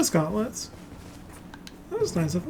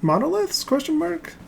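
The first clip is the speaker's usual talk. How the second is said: questioning rise; 10 semitones higher than usual. Real, not acted.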